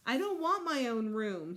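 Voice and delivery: mild mock child's shout. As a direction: shout it like a cross little boy. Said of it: "he shouted".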